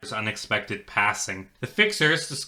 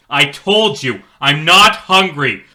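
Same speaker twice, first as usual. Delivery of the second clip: louder, slowed, defiant refusal; defiant shout; 5 semitones higher, 9 dB louder than usual. Acted. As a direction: throw the refusal back at him. Boy defies the cop.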